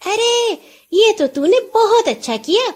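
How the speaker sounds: high, delighted praise. A narrator's reading. Nora praises her husband's trade, delighted. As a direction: beam with joy at the news. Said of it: "نورا خوش ہو کر بولی".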